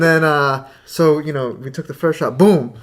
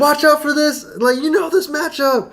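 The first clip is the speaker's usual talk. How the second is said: excited heckle, pitched way up; re-enacted yelling; 9 semitones higher than usual. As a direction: imitate a hyped spectator yelling advice. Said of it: "like, yelling".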